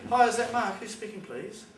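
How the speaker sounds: high, chirpy phone greeting; imitating a cheery collector on the phone; fake friendly caller. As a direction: mimic a saccharine debt collector. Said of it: "Like it's some friendly person".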